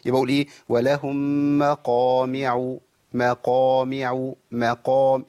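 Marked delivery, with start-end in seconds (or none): 0.7-4.3 مقامع with rounded lips, gentle; slow teacher demonstration; slow tajweed model. Shown as a demonstration. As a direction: draw out ولهم مقامع. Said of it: "حركة شفتين وسهلة".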